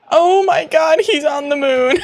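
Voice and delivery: can't believe he reached the moon; laughing disbelief. Real, not acted. Player laughing as the character flies to the moon.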